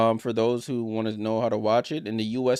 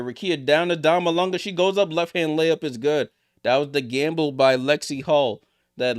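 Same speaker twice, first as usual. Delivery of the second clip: rising, louder live basketball call; play-by-play call; 4 semitones higher, 5 dB louder than usual. Acted. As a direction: call the drive and finish as it happens.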